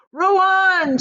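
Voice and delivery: high, loud commanding shout; Wonka's shout. A narrator's reading. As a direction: shout it to the rowers. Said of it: "shouted Mister Wonka".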